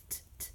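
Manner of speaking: "t" as a soft voiceless tap; pure t sound. Shown as a demonstration. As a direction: tap out a soft "t" twice, no vowel. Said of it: "also with the t".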